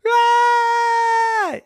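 very high, very loud yell; 尖声大喊; sudden shout. A narrator's reading. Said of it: "she heard a".

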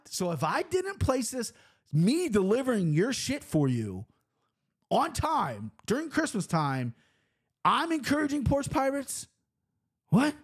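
high, outraged disbelief; indignant rant. Real, not acted. Driver venting about the sign, high and outraged. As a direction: let the outrage climb. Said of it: "set me off".

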